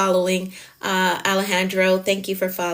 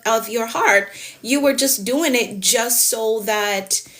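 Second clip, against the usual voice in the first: ordinary delivery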